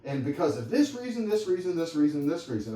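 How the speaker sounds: lightly voiced wife listing complaints; upset wife. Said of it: "she comes in and she's like".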